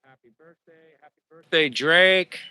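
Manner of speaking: ordinary delivery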